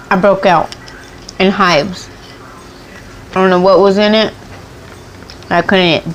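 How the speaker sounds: ordinary talk, nothing marked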